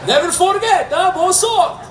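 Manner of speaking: put-on high, squeaky Portuguese old-timer; knowing João. João seeing Taylor off at graduation, high teasing call. João's parting words at graduation.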